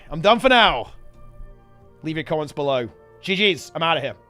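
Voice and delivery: normal talking voice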